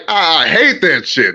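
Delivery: ordinary talk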